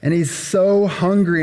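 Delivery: normal talking voice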